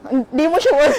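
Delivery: loud, playful jab at a friend; loud mocking doubt; loud teasing exclaim. Real, not acted. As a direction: shout it out teasingly.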